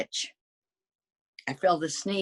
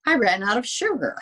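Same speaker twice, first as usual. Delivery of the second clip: quick, higher, louder dismay; dismayed exclamation; 8 semitones higher, 4 dB louder, faster than usual. Acted. Wolf telling readers of his trouble, quick dismay. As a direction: blurt out the mishap.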